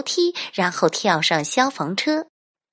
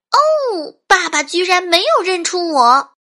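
put-on very high, loud little girl voice; dismayed child; 11 semitones higher, 6 dB louder than usual. A narrator's reading. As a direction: wail in disbelief as a kid. Girl shocked father didn't recognise her. (高亢惊讶的小女孩声音)

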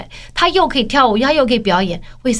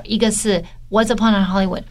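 ordinary delivery

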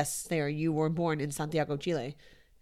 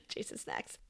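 whispered punchline; 14 dB quieter than usual. Real, not acted. Punchline after laughing.

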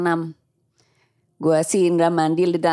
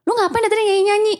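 accusing; 13 semitones higher, faster than usual. Retold or imitated. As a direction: demand an answer, rattled. Indra accusing her of singing. (menuduh dengan nada tinggi)

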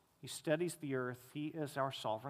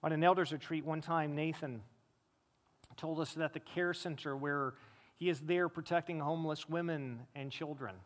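usual speaking voice

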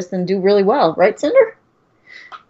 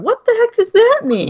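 puzzled relatives; 12 semitones higher, 3 dB louder than usual. Retold or imitated. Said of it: "everyone going".

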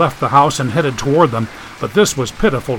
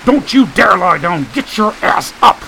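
angry; 6 semitones higher than usual. Acted. Yelling at friend lying down.

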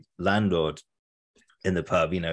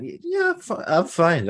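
flustered denial; 11 semitones higher, slower than usual. Retold or imitated. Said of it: "I said".

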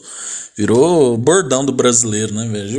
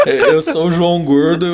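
ordinary delivery

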